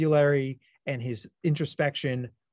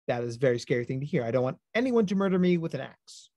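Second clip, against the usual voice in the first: ordinary talk, nothing marked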